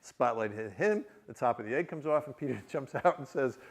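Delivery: normal talking voice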